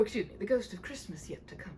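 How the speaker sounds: ordinary delivery, nothing marked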